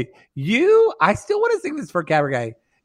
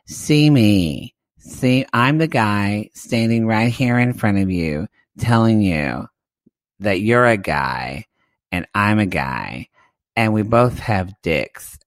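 low imitated Craig; 5 semitones lower than usual. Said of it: "He says".

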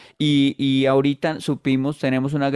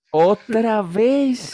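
mock disbelief; 8 semitones higher, 3 dB louder, much slower than usual. Mock-scolding a hungover friend.

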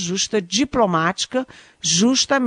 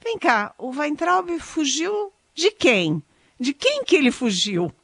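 higher-pitched ironic mock question, drawn out; heavy irony, quoted; 7 semitones higher than usual. Commentator quoting the Chamber president on the minister's flight, with heavy irony. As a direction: imitate a politician's pointedly ironic questioning. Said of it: "com um tom de muita ironia".

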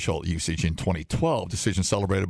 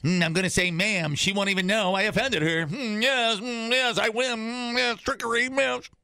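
higher, gloating scheming villain; a much higher, sly trickster hissing yes; sneaky schemer; 11 semitones higher than usual. Acted. Host voices a sly stranger tricking a woman with ma'am.

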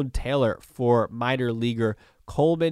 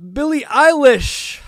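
excited announcement; 9 dB louder, slower than usual. Real, not acted. Surprise topic announced.

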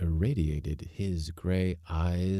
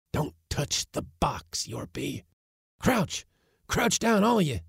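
put-on high, sharp old man orders; urgent old uncle; 11 semitones higher than usual. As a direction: bark orders at the crew in a high voice. Uncle commanding in hiding.

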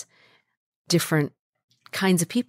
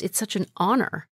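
ordinary delivery

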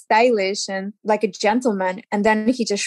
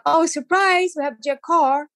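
cheery surprise; 6 semitones higher than usual. Retold or imitated. Host announces surprise guest.

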